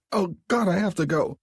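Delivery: high, strained, desperate cry; can barely hold it, pleading; urgent panic. Acted. Abby panics to Mark that she must go, urgent.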